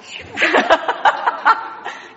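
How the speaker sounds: long high burst of laughter; hearty laugh. Real, not acted. Laughs at volunteer's confession.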